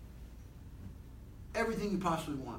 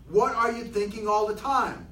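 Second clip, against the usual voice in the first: ordinary talk, nothing marked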